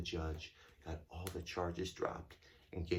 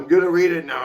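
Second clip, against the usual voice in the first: loud; 9 semitones higher, 20 dB louder, faster than usual. Real, not acted. Loudly answering child.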